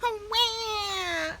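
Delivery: high mock wail; bird crying wah. A narrator's reading. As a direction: wail like a tiny chick. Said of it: "began to cry".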